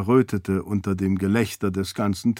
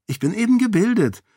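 put-on higher and quick, a miffed little girl; offended child insisting she is educated; huffy; 12 semitones higher, faster than usual. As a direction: snap it back proudly and fast. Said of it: "versetzte Kaise eingeschnappt".

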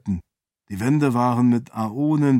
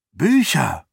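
excited exclamation, pitch leaping up; excited cry; 3 semitones higher, 3 dB louder than usual. A narrator's reading. Raoden discovering books in the hidden room, excited cry. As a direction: call it out with sudden excitement. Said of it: "rief Raoden aufgeregt".

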